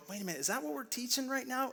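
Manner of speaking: a listener catching himself mid-thought, lightly voiced; puzzled double take. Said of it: "you're gonna go".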